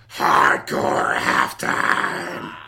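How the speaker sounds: very low, loud, drawn-out roar; slow, booming mock-epic announcement; segment announcer roar. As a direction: bellow the title like a hype announcer. Host announces a mock segment title.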